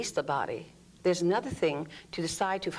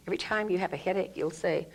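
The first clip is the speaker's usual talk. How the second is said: ordinary talk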